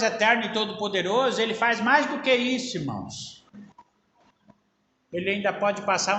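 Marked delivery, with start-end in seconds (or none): none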